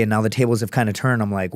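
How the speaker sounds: usual speaking voice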